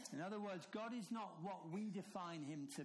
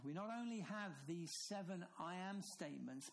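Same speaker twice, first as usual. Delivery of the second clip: ordinary talk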